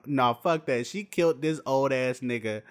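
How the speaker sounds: usual speaking voice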